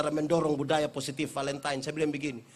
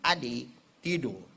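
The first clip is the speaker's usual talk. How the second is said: gentle coaxing; 7 semitones lower, slower than usual. Retold or imitated. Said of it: "kasih tahu dia baik-baik".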